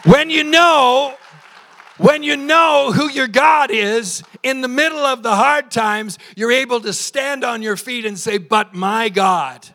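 high, loud, drawn-out pulpit cry; full-force preaching. Real, not acted. Pastor rallying believers in hard times, full-force preaching. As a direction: proclaim it over the whole room.

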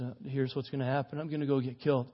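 soft, low, downcast delivery; somber. Acted. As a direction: say it low and subdued, resigned. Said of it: "all of a sudden turns really somber and says".

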